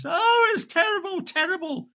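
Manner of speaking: very high, wailing woman's voice; hammed-up shocked barmaid; distressed barmaid. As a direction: barmaid just come round, wailing very high.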